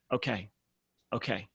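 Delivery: soft acceptance. Retold or imitated. A client softly accepting the coach's terms. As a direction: let it out softly, conceding. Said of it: "She became this soft, extremely vulnerable person".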